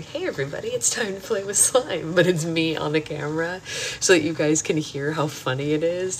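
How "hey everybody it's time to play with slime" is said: put-on light, pitched-up child voiceover; niece's little voice. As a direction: imitate a little kid greeting viewers. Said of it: "you just hear her voice going".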